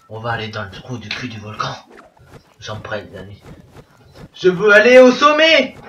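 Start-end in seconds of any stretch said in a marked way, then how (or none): 0.1-3.4 much lower and much softer, fast, in a strange put-on voice; eerie low murmur. Shown as a demonstration. To his viewers about diving into the volcan, eerie hushed low voice. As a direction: drop into a creepy hushed voice. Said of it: "repeats line in altered voice".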